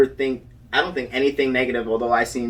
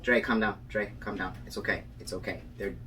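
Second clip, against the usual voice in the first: soft, low, calming voice; imitating a soothing friend; 5 semitones lower, 11 dB quieter than usual. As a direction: hush and reassure a panicking friend. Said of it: "She was like".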